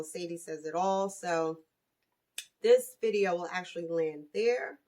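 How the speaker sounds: ordinary talk, nothing marked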